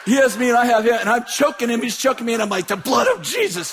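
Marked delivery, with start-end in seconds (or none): 2.7-3.7 high shouted plea; shouted rebuke. Retold or imitated. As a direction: yell it while wrestling. Preacher battling demon, shouting.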